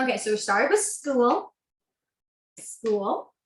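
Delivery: talking through hiccups; hiccuping between words. Real, not acted. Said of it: "have the hiccups".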